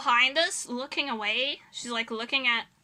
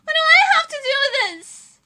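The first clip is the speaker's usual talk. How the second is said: high, loud incredulous protest; indignant disbelief; 13 semitones higher, 8 dB louder than usual. Retold or imitated. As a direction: protest the blame at a raised pitch. Indignant reaction to the blame.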